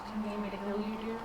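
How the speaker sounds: quiet words close to the ear; soft murmur. Acted. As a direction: lean in and murmur gently. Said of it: "lean down towards his ear and go".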